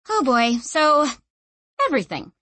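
weary sigh, sinking at the end. A narrator's reading. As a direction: start high, then let it sag. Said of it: "sighed Clef".

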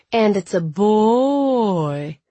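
high, slow, drawn-out taunting lilt; sing-song. A narrator's reading. Little brother teases sister about a caller, sing-song. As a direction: stretch BOY into a long teasing swoop. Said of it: "he sing-songed".

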